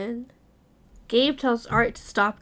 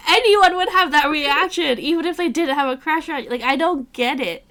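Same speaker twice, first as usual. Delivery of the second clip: louder and higher, fast incredulous rant; exasperated rant; 5 semitones higher, 7 dB louder than usual. Real, not acted. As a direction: vent at the character in disbelief. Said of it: "And I'm like".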